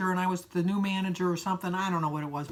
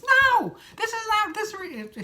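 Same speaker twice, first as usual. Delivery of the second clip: much higher and louder, cutting himself off; retold outburst; 12 semitones higher, 4 dB louder, slower than usual. Boss Ted protesting the waitress called me sir, high outraged outburst. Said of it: "He went ballistic".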